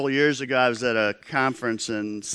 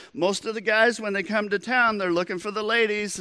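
high-pitched, chatty explanation; bigger-than-life waiter; 7 semitones higher than usual. Retold or imitated. Waiter explains himself to uninterested diners, bigger than life. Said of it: "bigger than life".

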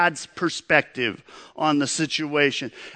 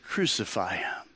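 low, soft, much quieter retelling of the crowd; crowd answer; 7 semitones lower, 8 dB quieter than usual. Retold or imitated. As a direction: let the cry drop away, hushed and heavy. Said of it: "And they all answered".

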